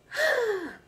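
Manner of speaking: high, sudden gasp; imitated gasp. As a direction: mimic a startled intake of breath. Woman on stage sees him.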